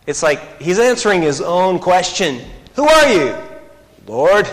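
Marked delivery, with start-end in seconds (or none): 2.8-4.5 stunned cry. Acted. Saul cries out, stunned.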